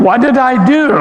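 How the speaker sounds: rising, anxious mock worry; worried inner thought. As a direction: replay the nervous question to yourself. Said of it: "the entire service I'm thinking".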